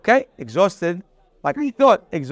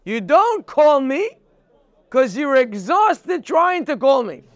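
emphatic shout; 5 semitones higher than usual. Shown as a demonstration. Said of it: "The pasuk makes no sense".

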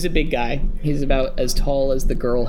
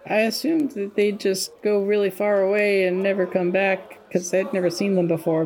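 higher, lighter character voice; NPC voice; 7 semitones higher than usual. Acted. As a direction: play the nervous NPC, quickly. NPC speaking to players.

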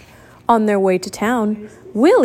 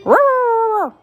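puppy roar; 13 semitones higher than usual. A narrator's reading. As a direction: roar like a fierce little puppy. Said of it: "went Willie".

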